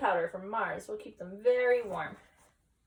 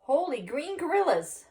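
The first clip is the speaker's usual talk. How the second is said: gulping surprise; 7 semitones higher, 4 dB louder than usual. Acted. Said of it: "gulped Scapito".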